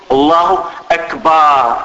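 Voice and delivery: slow takbir, أكبر stretched to "akbaaar"; long alif in akbar. Shown as a demonstration. Teacher recapping the mistake for students, أكبر dragged into "akbaar". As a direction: hold the vowel between ب and ر in أكبر. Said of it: "إدخال ألف بعد الباء".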